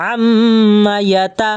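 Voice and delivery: "আম্মা ইয়া তা" with long hummed m, then quick syllables; chanted hum then brief vowels; ghunnah, short vowels. Shown as a demonstration. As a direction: hold the m, cut "ইয়া তা" short. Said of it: "তাহলে তাড়াতাড়ি আমরা পড়বো উচ্চারণ".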